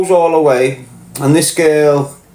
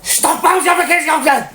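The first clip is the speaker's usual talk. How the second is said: much higher, shouted telling-off; big shout; 13 semitones higher than usual. Shown as a demonstration. Parent finally losing it at kids on the counter, big shout. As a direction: yell it like over a microphone. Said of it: "This was a big shout".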